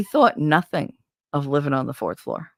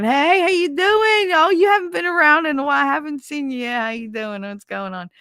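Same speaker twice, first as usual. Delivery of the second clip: high singsong greetings, gradually fading; neighbors calling hello; 10 semitones higher, 3 dB louder than usual. Retold or imitated. Neighbors greeting her from windows, high hollered hellos dying away. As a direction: wave from the window, starting high and settling down. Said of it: "And people waving".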